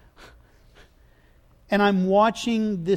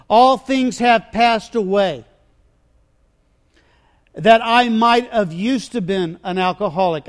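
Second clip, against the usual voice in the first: loud, driving preacher delivery; impassioned preaching; 8 dB louder than usual. Real, not acted. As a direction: preach it with forceful conviction. Preaches at full force.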